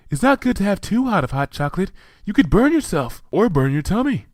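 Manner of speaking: fretful Fluttershy. A narrator's reading. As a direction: fuss over it like a timid caretaker. Fluttershy frets about hot cocoa.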